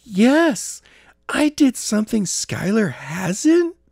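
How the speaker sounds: high, slow, gleeful boast; excited. Acted. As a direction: savour the little win. Jenna gleeful at victory.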